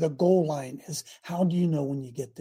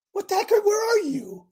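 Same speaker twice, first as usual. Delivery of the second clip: much higher, bewildered call into the sky; exasperated prophet; 14 semitones higher than usual. Acted. Preacher playing Habakkuk calling for God, high bewildered call. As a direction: throw the question up high like you're lost.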